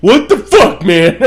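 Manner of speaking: high, loud mock outrage; indignant. Imitating the woman's reaction to eaten food, indignant. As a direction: pitch it up in protest.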